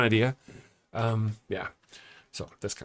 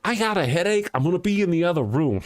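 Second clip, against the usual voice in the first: mock whiny excuse; 4 semitones higher than usual. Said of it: "then I go".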